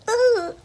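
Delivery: short, very high mock squeal; mock freaked-out squeal. Little girl freaking out, mock squeal. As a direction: imitate a little kid's high yelp. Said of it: "of course, she freaks out".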